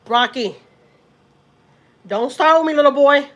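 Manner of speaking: loud scolding shout at Rocky; yelling at the dog. Real, not acted. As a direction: bark it out at the dog, fed up.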